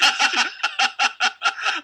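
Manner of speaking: high, loud laughing fit; laughter. Real, not acted. Laughing at the runaway chicken idea, loud high cackling. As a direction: crack up loudly.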